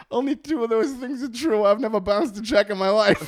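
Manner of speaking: high, loud comic protest; mock-indignant defence. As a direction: object hotly with laughter brimming. His retold defence to the police.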